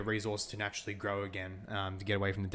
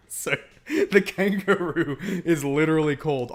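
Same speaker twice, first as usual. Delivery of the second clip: words squeezed out through real laughter, higher and louder; laughing while talking; 6 semitones higher, 10 dB louder than usual. Real, not acted. Streamer still cracking up while retelling, laughing. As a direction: talk through the giggles.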